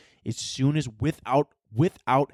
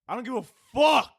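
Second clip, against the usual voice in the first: high-pitched burst on FUCK; emphatic outburst; 10 semitones higher than usual. Pretend. Answering whether he cares about parents' inconvenience, emphatic outburst. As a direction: punch the last word way up high.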